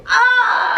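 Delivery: high, loud "ah" of amazement; amazed gasp-cry. Acted. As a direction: cry out in mock awe. Acts out amazed onlookers.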